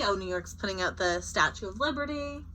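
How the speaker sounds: ordinary delivery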